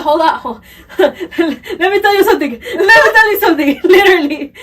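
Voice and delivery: high, loud laughing plea; can barely get the words out for laughing; laughing through it. Retold or imitated. To the listener, retelling her teenage plea, laughing loud and high.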